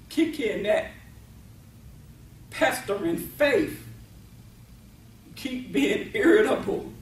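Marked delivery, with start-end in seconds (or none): none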